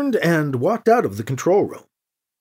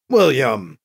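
low, firm, falling rebuke; angry; 4 semitones lower than usual. A narrator's reading. Anita rebukes Gentry for his teasing, angry. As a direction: cut him off with the name, angrily. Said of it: "Anita sounded authentically angry now".

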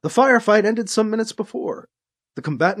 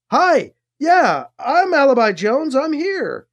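higher, louder answering call; calling back; 7 semitones higher, 4 dB louder, slower than usual. A narrator's reading. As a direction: answer the trooper across the hall. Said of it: "Alibi called back".

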